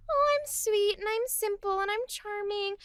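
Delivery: soft, high, cutesy voice; sweet and charming. Acted. Woman charming a man, hair behind ear, soft and cute. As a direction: play sweet and simple, softly and high. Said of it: "soft little cute thing".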